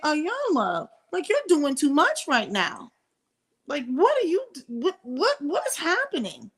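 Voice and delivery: high-pitched, incredulous scolding; exasperated disbelief. Retold or imitated. Scolding Iyanla in disbelief, high and exasperated. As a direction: throw up your hands, pitched high.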